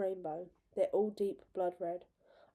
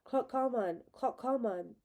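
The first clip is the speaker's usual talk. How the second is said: higher, loud call for help; 4 semitones higher, 4 dB louder, slower than usual. A narrator's reading. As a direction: call across the meadow. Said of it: "I begin to call for help".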